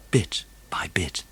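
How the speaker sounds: usual speaking voice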